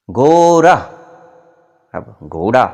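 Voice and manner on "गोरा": loud, high गोरा with R for the hard ḍ; teacher's mispronunciation. Acted. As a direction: call out गोरा to the class, R replacing ḍ. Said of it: "तो हमसे कहता कि बोलो बच्चों". (ड़ को र बोलते मास्टर, ऊँची आवाज़ में गोरा)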